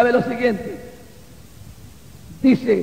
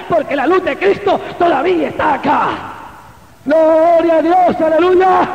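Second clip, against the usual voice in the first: preaching that rises into loud, high praise; prédica exaltada; 5 semitones higher, 5 dB louder than usual. Real, not acted. Preaching at full force. (prédica que sube hasta la alabanza a gritos)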